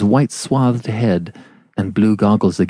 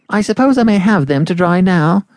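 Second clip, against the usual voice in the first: insistent landlady; firm, no denial; 8 semitones higher than usual. Acted. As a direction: brook no argument, higher. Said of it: "in a voice that brooked no denial".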